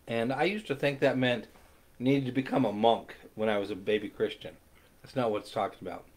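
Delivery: ordinary talk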